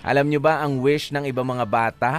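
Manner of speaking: ordinary talk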